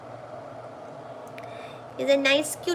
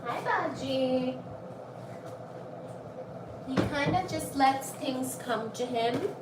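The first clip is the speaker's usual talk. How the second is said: soft talk; 15 dB quieter than usual. Real, not acted. Much softer talk.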